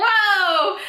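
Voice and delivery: playful shocked whoa; surprised whoa. Real, not acted. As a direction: react like you heard a swear. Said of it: "I said".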